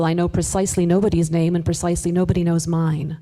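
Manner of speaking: ordinary talk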